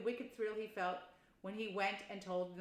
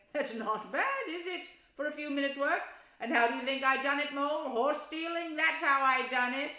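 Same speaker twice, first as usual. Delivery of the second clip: boastful Toad; 7 semitones higher, 9 dB louder than usual. Acted. Toad brags about theft.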